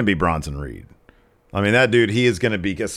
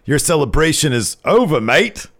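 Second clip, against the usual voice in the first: higher, mocking Australian accent; hammed-up Australian, pitched up; 8 semitones higher, 4 dB louder than usual. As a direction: taunt in a put-on Aussie accent. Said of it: "then he does an Australian accent".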